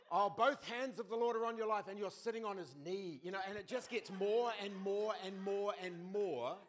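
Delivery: growing mock excitement; escalating excitement. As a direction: pump it up like runaway hype.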